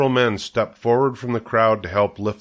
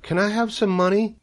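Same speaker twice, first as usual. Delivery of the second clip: put-on high-pitched little girl voice; child asking; 12 semitones higher than usual. A narrator's reading. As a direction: plead like a small girl, much higher. Child asks mother for money.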